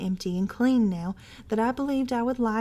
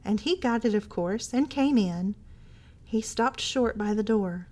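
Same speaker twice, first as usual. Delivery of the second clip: ordinary delivery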